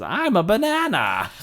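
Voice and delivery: high, loud silly cry; giddy silliness. Retold or imitated. Ice King rocking like a fruit, giddy and silly. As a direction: sing out like a giddy fool. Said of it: "Starts giggling and".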